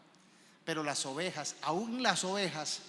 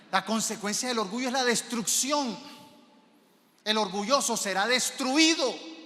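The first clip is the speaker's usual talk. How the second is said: loud, raised-pitch pulpit warning; forceful preaching; 5 semitones higher, 7 dB louder than usual. Real, not acted. Preacher warns congregation about pride's consequence, forceful preaching. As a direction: preach it at full force, hitting it hard.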